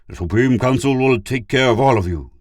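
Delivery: high, loud and angry; snarled threat. A narrator's reading. Pid threatens the traitors, snarling. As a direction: snarl it as a warning. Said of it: "he snarled".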